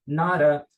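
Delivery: "Not a" joined into "nara". Shown as a demonstration. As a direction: flap the T before the vowel in "Not a". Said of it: "quando ele vem antes de uma vogal". ("Not a" ligado, T virando R)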